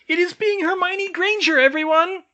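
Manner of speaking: very high, loud squeaky announcement; excited elf call. A narrator's reading. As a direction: call out to the whole kitchen. Elf announces Hermione to all.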